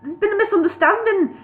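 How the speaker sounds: high-pitched flustered hobbit; flustered gabble. A narrator's reading. Bingo confronting the unexpected dwarfs, high flustered gabble. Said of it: "Bingo gabbled at them".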